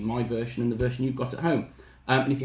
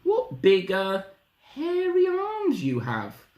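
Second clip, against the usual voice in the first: put-on high, slow wondering girl; careful Little Red; 9 semitones higher, slower than usual. Little Red eyeing Granny's arms, high careful voice. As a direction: spell it out carefully, pitched high. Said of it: "Little Red said carefully".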